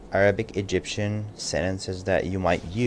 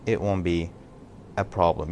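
ordinary delivery

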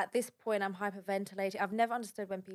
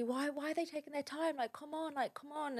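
frustrated plea; 7 semitones higher, 4 dB quieter than usual. Retold or imitated. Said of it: "So I'm like to the lady".

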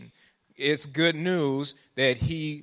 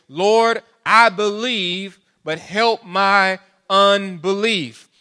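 loud, slow pleading cry to the Lord; tearful plea; 6 semitones higher, 9 dB louder, much slower than usual. A narrator's reading. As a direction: cry it out slowly, begging for help. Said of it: "cried out and said with tears".

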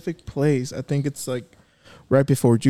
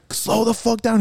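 urgent plea; 8 semitones higher than usual. Retold or imitated. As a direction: imitate an alarmed passenger demanding it. Retells yelling at his brother.

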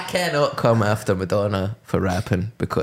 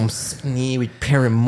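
imitating Zane Lowe; 4 semitones higher than usual. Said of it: "the full Zane Lowe interview".

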